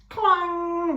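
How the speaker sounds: sharp metallic clang, high and loud. A narrator's reading. Ram's horns hitting the robot, a loud clang. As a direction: ring it out like struck metal.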